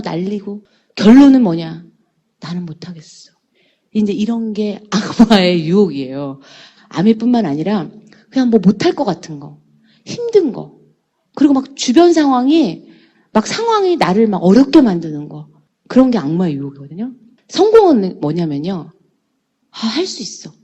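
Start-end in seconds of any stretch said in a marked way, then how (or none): 2.4-3.3 much softer, defeated aside; resigned giving up. Acted. Person worn down by family objections, softly resigned. 19.7-20.5 soft, private resolve; quiet self-encouragement. Acted. Person resisting temptation, quiet self-encouragement.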